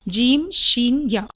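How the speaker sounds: "जीम", "शीन" drawn out slowly, then "या"; slow letter demo. Shown as a demonstration. Teacher slowly sounding out the letters for students. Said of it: "आप ये खुद महसूस होगा".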